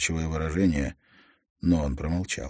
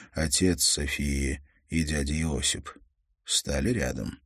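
plain reading voice

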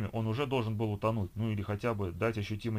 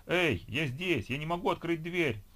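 trapped man calling out, higher and slow; calling for help; 7 semitones higher, slower than usual. A narrator's reading. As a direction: shout through the locked door for help. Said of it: "продолжил биться я". (крик о помощи из запертой каюты)

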